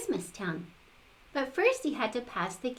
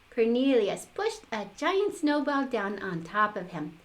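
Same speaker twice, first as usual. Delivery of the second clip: ordinary delivery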